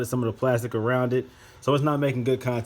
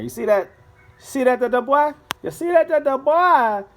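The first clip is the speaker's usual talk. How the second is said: excited squeal; 13 semitones higher, 8 dB louder than usual. Real, not acted. Showing burnt, melted bulb contact.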